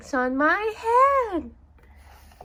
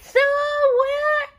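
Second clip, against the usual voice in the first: high, loud pleading wail; desperate cry; 5 dB louder than usual. Acted. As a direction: howl it out in desperation. Gerald begs them to leave.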